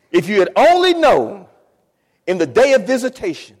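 high, loud preacher's plea; full-force preaching. Acted. Preacher voicing Jesus' lament, high impassioned shout. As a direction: cry out the lament to the congregation.

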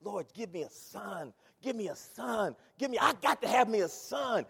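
earnest prayer building up; pleading prayer. Retold or imitated. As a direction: beg the Lord for a son. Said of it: "She said".

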